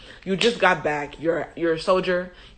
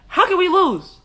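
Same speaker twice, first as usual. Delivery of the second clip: cocky excitement; 11 semitones higher, 4 dB louder than usual. Acted. Voicing overconfident army.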